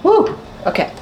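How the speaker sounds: high excited woo. Real, not acted. Cook psyching herself up at the stove, excited woo. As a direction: let out a high whoop.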